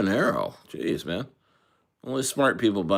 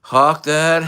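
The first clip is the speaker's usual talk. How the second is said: higher-pitched mock questioning; retold accusation in a raised voice; imitated interrogator; 6 semitones higher than usual. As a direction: act out a superior confronting someone, climbing high at the end. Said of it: "They were like".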